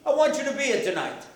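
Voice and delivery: put-on high childlike plea; mock tearful pleading; pleading little boy. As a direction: imitate a child begging a parent to stay. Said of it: "the kid stands by the door".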